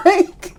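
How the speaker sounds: loud high cackle breaking out; can't hold back a loud laugh; high burst of laughter. Real, not acted. Cracking up at the story.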